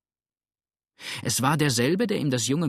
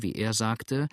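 usual speaking voice

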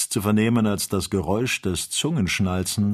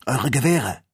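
higher, louder urgent call; 6 semitones higher, 6 dB louder than usual. A narrator's reading. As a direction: call out an order to companions. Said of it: "rief Ferguson". (lauter, dringender Zuruf)

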